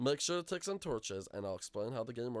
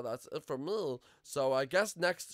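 ordinary talk

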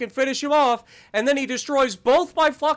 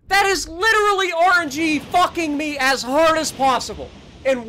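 loud, furious outburst at the screen; yelling in disbelief at the game; real angry rant; 5 semitones higher, 3 dB louder than usual. Real, not acted. Gamer raging at his bad luck, loud and furious.

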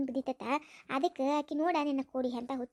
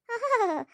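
mocking laugh; 7 semitones higher, 4 dB louder, slower than usual. Mocking laugh at mother-in-law.

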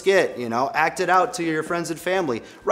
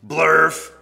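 nonsense word; 4 semitones higher, 6 dB louder than usual. Acted. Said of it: "even if the words say".

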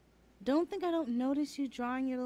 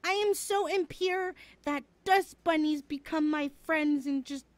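louder, hammed-up grand proclamation; melodramatic declaration; 5 semitones higher, 7 dB louder than usual. Joking about her impurity to a friend, hammed-up loud. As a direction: declare it like a tragic heroine.

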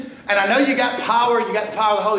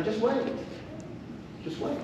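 fading, softer just wait; soft knowing warning; 2 semitones higher, 12 dB quieter than usual. Retold or imitated. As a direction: let it trail off knowingly. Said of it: "I just laugh and say".

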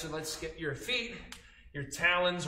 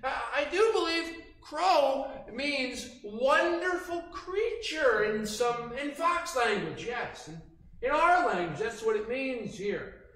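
playful fox voice; 8 semitones higher, 4 dB louder than usual. Acted. Teacher keeps fox voice joking.

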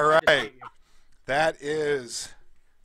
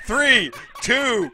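high, loud, drawn-out countdown; announcer countdown; 7 semitones higher, 5 dB louder, much slower than usual. Real, not acted. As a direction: count the fighters in with gusto.

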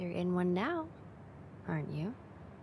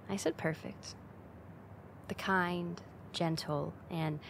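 ordinary talk, nothing marked

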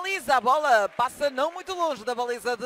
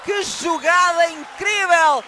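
excited shout; 5 semitones higher, 6 dB louder, slower than usual. Real, not acted. Commentator reacts to great play.

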